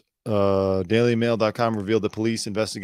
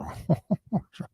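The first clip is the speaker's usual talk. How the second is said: creepy chuckle; 9 semitones higher, 5 dB quieter than usual. Retold or imitated. Creepy killer laughing to himself.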